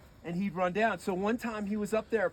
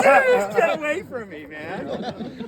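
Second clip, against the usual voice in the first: exasperated brush-off; 8 semitones higher, 9 dB louder, slower than usual. Retold or imitated. Narrator shooing Mike away.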